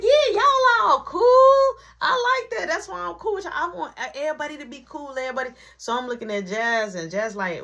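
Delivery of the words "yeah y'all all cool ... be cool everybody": imitating Liz. Liz gushing to the friends, high and laughing. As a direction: ham up Liz's gushing, tipsy friendliness. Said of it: "So Liz like".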